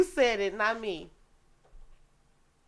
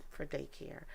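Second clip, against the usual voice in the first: ordinary talk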